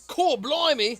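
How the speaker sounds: mock Australian exclamation. Mocking game's accents.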